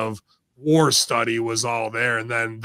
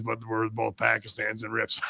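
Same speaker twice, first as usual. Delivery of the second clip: ordinary delivery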